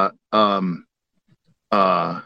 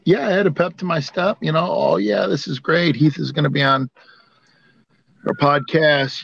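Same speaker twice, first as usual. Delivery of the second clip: high-pitched giddy excitement; bouncy, thrilled and much higher; excited; 6 semitones higher than usual. Retold or imitated. Retelling his joy about Heath.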